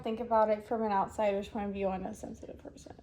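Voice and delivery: hushed, half-whispered admission. Real, not acted. Speaker whispers while admitting sensitivity. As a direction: confess it under your breath.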